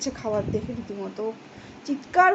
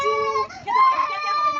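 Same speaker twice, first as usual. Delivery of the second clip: loud hush; 4 dB louder than usual. Real, not acted. Mother shouts at the crying daughter to stop, loud and high. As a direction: shout over the crying, then relent.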